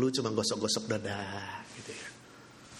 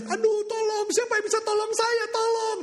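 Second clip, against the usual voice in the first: much higher frantic yell; panicked cry for help; 4 dB louder than usual. Acted. Terrified wife crying out for rescue, high frantic yell. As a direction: scream for anyone to save her. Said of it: "Dia berteriak".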